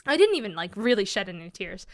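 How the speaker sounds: ordinary delivery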